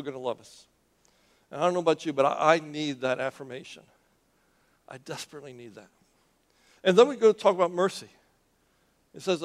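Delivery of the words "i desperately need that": quiet confession. Real, not acted.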